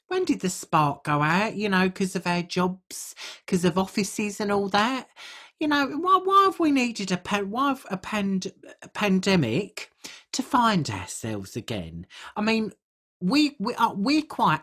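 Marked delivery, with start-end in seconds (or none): none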